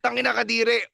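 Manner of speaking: high-pitched mock disgust; half-yelled swearing, retold; retold yelling out. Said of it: "I was yelling out".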